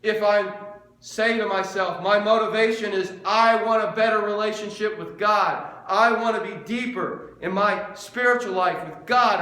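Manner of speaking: loud, high and driving, preaching hard; full-force preaching. Acted. Pastor to his congregation, preaching loud and hard. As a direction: preach it out to the whole room.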